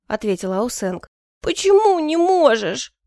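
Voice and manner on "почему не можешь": high, loud, drawn-out wail; whimpering surprise. A narrator's reading. Favourite whimpers at the emperor in genuine surprise, wailing. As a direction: protest slowly in a high, whiny sob. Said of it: "натурально всхлипнула девушка с неподдельным удивлением".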